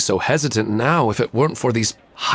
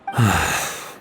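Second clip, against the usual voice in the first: heavy sigh; 3 semitones lower than usual. Acted. Widower's heavy sigh of grief.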